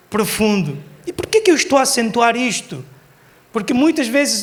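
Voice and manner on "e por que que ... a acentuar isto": usual speaking voice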